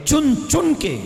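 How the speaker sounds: ordinary talk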